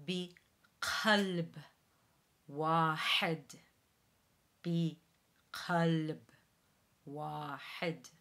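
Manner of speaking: Arabic repetition with uvular Q; Arabic model. Shown as a demonstration. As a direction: repeat بقلب with the Q back in the throat. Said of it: "So once again".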